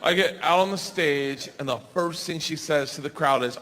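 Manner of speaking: ordinary talk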